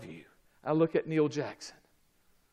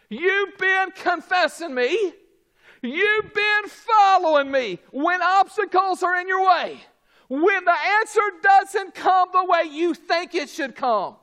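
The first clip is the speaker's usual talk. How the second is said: high, loud preacher voicing Jesus; forceful preaching; 8 dB louder than usual.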